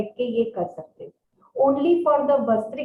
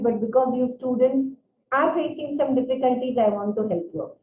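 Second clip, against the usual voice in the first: normal talking voice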